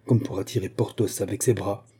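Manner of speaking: ordinary delivery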